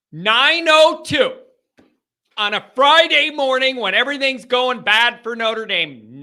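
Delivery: loud, high, incredulous outburst that eases off; exasperated yell. Real, not acted. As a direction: raise your voice in real exasperation. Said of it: "not letting you make me yell".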